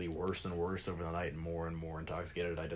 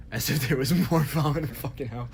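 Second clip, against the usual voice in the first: words bursting out through laughter, much louder and higher; laughing; 6 semitones higher, 8 dB louder, faster than usual. Real, not acted. Telling friends about the drunk's question, laughing loudly. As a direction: laugh it out, amused at the absurdity.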